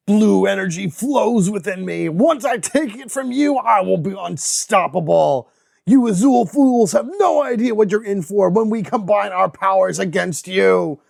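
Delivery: menacing villain boast, slowing on the threats; a scheming bad guy taunting the heroes; villain Psycho Blue. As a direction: gloat like a cartoon supervillain.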